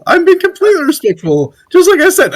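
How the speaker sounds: high-pitched, insistent objection; indignant protest. Real, not acted. Protests being called disrespectful.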